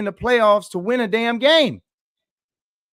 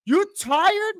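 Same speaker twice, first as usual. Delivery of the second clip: outraged shout; 9 semitones higher than usual. Real, not acted. Analyst blasting the player's excuse, rising to a furious shout.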